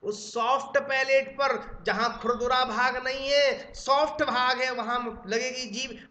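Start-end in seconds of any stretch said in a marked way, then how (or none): none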